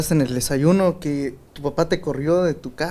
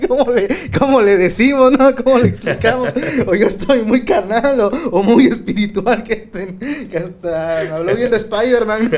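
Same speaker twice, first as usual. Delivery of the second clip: words squeezed out through real laughter, pitched higher; laughing while talking; 7 semitones higher, 4 dB louder than usual. Real, not acted. Cohost cracking up at the Spider-Man story, laughing talk. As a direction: giggle your way through the question.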